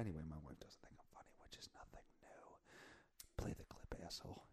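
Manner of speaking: soft whispered aside; whispering. Real, not acted. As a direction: whisper it behind her back.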